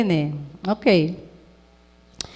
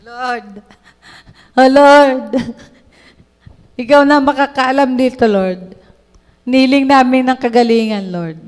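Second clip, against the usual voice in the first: earnest prayer; 2 semitones higher, 4 dB louder than usual. Retold or imitated. Retold healing prayer over child.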